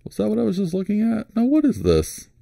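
high-pitched baffled questioning; puzzled. Real, not acted. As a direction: think aloud in a high, wondering voice. Still puzzled, checking the listing.

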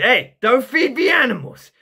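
high, loud warning shout. Acted. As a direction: holler it in a cartoonish put-on voice. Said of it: "I just played that part straight away".

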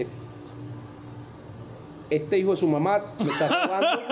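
high, laughing amazed coach; laughing coach quote. Retold or imitated. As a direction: retell the coach's disbelief through laughter.

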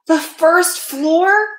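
very slow, high-pitched astonished echo, rising; incredulous boy's drawn-out question; disbelieving. A narrator's reading. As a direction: stretch out "first floor" in amazement. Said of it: "Keith could not believe it".

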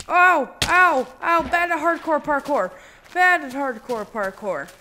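loud high ow on taking damage; loud real ouch then a rueful groan; pained yelp. Real, not acted. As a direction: yelp as you botch the jump.

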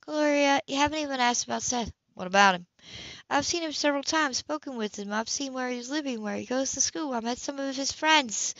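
lightly voiced prim sister. Sybil voiced by narrator.

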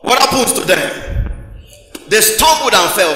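loud sermon call; loud preaching. Real, not acted. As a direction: drive it home loud to the church. Preacher raising voice to congregation.

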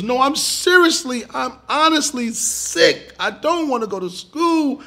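put-on high, slow, whining child insisting he is sick; pleading sick kid. Boy acting sick to his pretend mother, high slow whining. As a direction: drag out the pleading. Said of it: "He goes".

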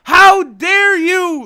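high, loud, slow outraged cry; a hammed-up defeated villain's fury. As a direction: drag out the indignation. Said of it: "is like".

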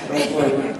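ordinary delivery

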